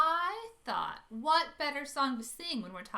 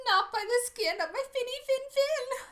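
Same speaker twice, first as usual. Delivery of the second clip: high, squeaky little fish; a little fish refusing the shark, high-pitched; trembling little fish; 11 semitones higher, 7 dB louder than usual. Acted. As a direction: answer high and small like a tiny fish. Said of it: "The little fish trembled and replied".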